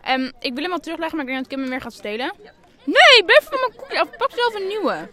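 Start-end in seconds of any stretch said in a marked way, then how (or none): 2.8-5.1 high, loud, slow protest. Real, not acted. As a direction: shout to keep your cookie. Ruzie om koekje. (luid protesterend)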